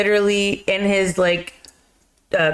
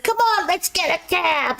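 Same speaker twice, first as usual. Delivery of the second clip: much higher, cheerful sidekick voice; pretzel guy; 10 semitones higher than usual. Retold or imitated. Pretzel seller urging Hercules into a cab, much higher voice. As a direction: pitch it way up like a chirpy pretzel vendor. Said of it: "he's like".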